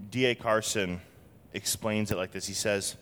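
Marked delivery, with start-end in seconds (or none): none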